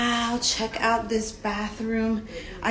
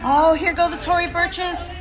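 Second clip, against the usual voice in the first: high, giddy cry as the shoes fly; excited yelp; 6 semitones higher than usual. Real, not acted. Sliding down in a dress, she calls out excitedly as her shoes fly off. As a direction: laugh it off mid-slide.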